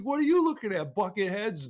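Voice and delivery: high, sneering taunt that drops off at the end; mocking jerk. Vaporized TVA guy taunting the guards, high and sneering. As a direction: sneer it up high then let it fall. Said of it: "He's like".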